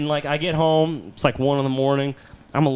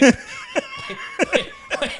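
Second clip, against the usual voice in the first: tiny squeaked pleading; dismayed protest; much slower than usual. Retold or imitated. Man told the park just closed, dismayed squeal. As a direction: squeal in disbelief, extremely high.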